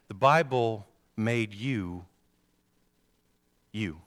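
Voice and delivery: slow, weighty first statement; measured sermon emphasis; slow emphatic. Shown as a demonstration. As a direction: lay it down slowly. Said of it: "Let me say that one more time".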